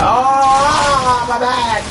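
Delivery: loud, high mock howl; hammed-up yelp of pain, drawn out; acted pain cry. Vlogger re-enacting his back popping, hammed-up pain cry.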